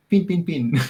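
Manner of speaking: high, playful New Zealand vowels on "Pin"; Kiwi accent. Shown as a demonstration. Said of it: "We do a Kiwi one".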